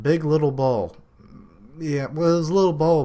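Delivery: incredulous, laughing emphasis; amused emphasis. Real, not acted. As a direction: exclaim at the huge big little bowl. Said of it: "good lord, it was stacked that tall".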